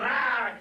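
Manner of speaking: imitated dog snarl; attacking dog. Storyteller mimics the dog attacking a puppy, snarling. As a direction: snarl like a dog grabbing its prey. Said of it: "it's grabbing, it's going".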